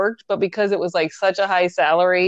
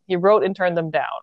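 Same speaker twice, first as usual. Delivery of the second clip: normal talking voice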